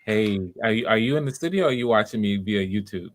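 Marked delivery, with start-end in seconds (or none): none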